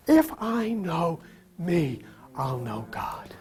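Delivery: slow, fading into a whisper; said to yourself. Shown as a demonstration. Preacher showing the congregation what to say to themselves, whispered. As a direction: say it slowly, sinking to a whisper. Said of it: "Say that to yourself".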